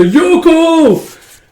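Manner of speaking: high, loud imitated call to a friend. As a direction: call her name across the field. Rescuer calling from dune buggy.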